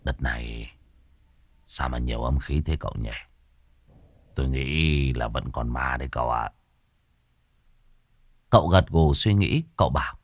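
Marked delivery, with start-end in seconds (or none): 0.0-6.5 low, soft weary voice; feeble rasp. Acted. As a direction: barely get the words out. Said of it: "Môn Phọt thều thào". (giọng thều thào, trầm và nhỏ)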